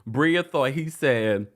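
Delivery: usual speaking voice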